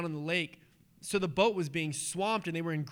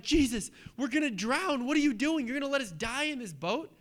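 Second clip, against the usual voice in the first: panicked shout; 7 semitones higher, 3 dB louder than usual. Retold or imitated. Disciples panicking in the storm.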